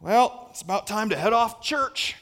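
put-on light southern accent, ho-hum; a hint of a southern cowboy's ho-hum accent. Ho-hum churchgoer heading off to church, light southern accent. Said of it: "I always go into a southern accent when I do that".

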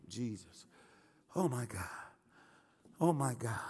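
low, soft, spaced-out murmur; hushed awe. Real, not acted. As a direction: drop to a reverent hush, as if overcome. Overcome by the name, murmurs.

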